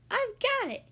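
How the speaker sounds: squeaky high eureka; excited mouse. Acted. Mouse gets an idea, squeaks it out high. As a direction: mouse bursts out with his idea. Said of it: "he said".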